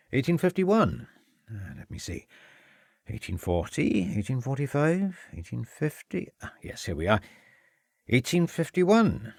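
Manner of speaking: polite, slightly guarded headmaster; Welsh headmaster. A narrator's reading. Headmaster receiving the inspector, light Welsh voice. Edwards searching records.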